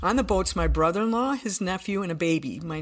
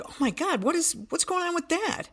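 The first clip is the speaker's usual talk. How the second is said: alarmed surprise; 12 semitones higher than usual. Retold or imitated. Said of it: "we're like".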